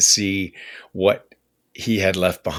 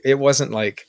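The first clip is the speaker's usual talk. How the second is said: ordinary talk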